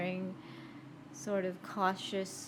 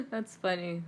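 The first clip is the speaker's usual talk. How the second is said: normal talking voice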